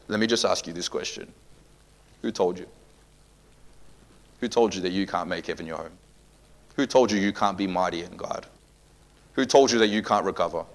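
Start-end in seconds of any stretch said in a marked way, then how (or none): none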